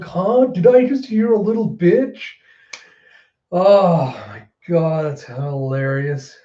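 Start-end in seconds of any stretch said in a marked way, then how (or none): 0.0-2.2 high, sneering taunt; a mildly hammed-up sneering threat; mock menace. King mocking Orochi in a high sneering taunt. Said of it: "Just being like".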